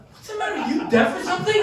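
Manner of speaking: very high mimicked nagging question; exasperated wife. Wife challenges husband pretending to be deaf, high and exasperated. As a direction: pitch it way up as the fed-up wife. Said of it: "And she goes".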